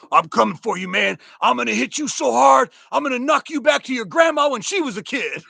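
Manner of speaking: high, loud boasting threat; trash talk. Retold or imitated. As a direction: talk big like a cocky young player. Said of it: "trash talker".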